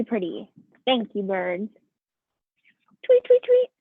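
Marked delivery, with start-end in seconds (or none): 3.1-3.7 very high, louder chirping bird. Acted. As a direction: chirp like a tiny songbird. Birds thanked again.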